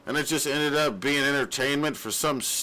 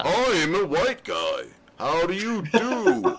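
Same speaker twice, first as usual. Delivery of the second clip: joking fake American accent at his friends; mock American accent. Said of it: "put on his fake American accent".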